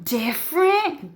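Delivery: high-pitched incredulous question; mock European. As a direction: squeal the word in mock disbelief. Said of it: "now they're all like".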